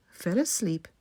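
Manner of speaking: usual speaking voice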